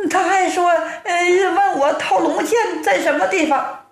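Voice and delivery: high-pitched wailing wife voice; storyteller's acted weepy wife; tearful wife. A narrator's reading. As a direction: greet your husband in a high, fretful lament. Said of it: "正在屋中掉眼泪呢".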